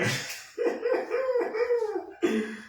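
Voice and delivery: real high-pitched giggle; high laugh. Real, not acted. Amused by the scene, laughs up high. Laughing at her own retelling.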